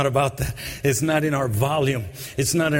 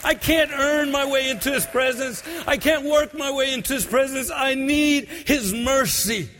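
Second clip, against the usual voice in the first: desperate cry for mercy; 11 semitones higher than usual. Acted. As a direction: cry it out, begging God. Preacher voicing woman's cry, shouted.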